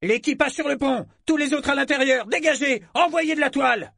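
very high, carrying order voice; shouted commands. A narrator's reading. Lieutenant sends the crew to stations, shouting. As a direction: call orders across a crowded deck.

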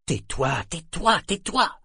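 repeated order climbing sharply in pitch; urgent self-scolding. A narrator's reading. Inner voice begging him to stop talking, urgent.

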